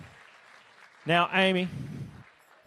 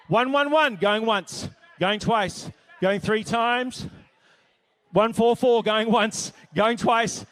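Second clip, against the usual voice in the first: loud, rapid-fire auction call; auctioneer calling bids; 6 semitones higher, 4 dB louder, slower than usual. Acted. Auctioneer at a charity gala, calling out bids loudly. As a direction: sell it hard from the podium, higher and louder.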